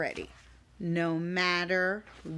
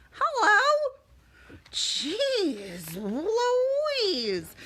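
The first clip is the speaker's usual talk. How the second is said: silly voice; 11 semitones higher, much slower than usual. Acted. Silly voice greeting.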